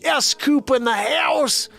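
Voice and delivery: high, loud, stretched-out name calls; rousing shout-outs. Real, not acted. Host greeting viewers in the house, high hollered shout-outs. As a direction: holler each name like a hype man.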